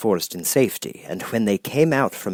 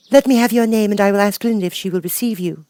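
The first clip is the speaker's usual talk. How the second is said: high, louder formal question; lightly voiced guard girl; 11 semitones higher, 3 dB louder, faster than usual. Uniformed gate girl questioning Dorothy, high formal. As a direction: challenge politely at the gate.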